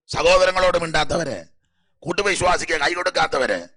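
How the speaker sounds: a pulpit warning, loud and insistent; preaching at full force. Real, not acted. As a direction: thunder out the list of the unworthy. Preacher lists the unworthy.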